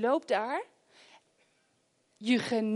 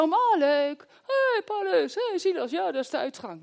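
mock cheerful jailer; 6 semitones higher than usual. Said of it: "Het is niet zo".

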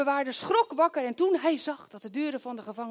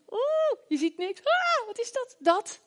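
much higher startled yelps; mock fright; 12 semitones higher, slower than usual. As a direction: gasp as if something moves in the dark. Imagining the dark dungeon.

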